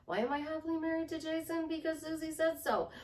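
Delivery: high, quick, sustained-pitch recitation; playful self-questioning. Acted. Happy wife affirming her marriage, playful high singsong. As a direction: rattle it off high and giddy.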